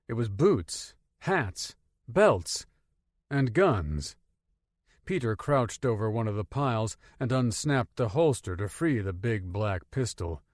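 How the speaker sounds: ordinary delivery, nothing marked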